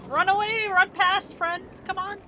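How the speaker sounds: high, loud shout to flee; yelling at a teammate to run; urgent call. Real, not acted. Calling a friend in game to run away, loud urgent shout.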